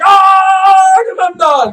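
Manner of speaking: long, high shriek into the name; horrified scream. Acted. Crowd discovering the murdered king, screaming. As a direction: scream the name in mock horror.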